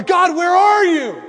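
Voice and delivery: high, drawn-out wail to God; anguished cry. Retold or imitated. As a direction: cry out to heaven, stretched slow. People crying out to God.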